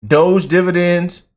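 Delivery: normal talking voice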